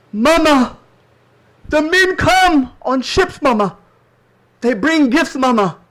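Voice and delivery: high, loud, urgent calling out; excited son. Acted. Son telling Mama of ships bringing gifts, excited loud calling. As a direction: cry out to Mama like an eager child.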